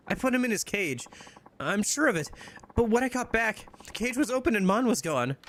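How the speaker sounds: put-on high, upset girlfriend voice calling to Majima; distressed young woman. Heroine explains missing cat.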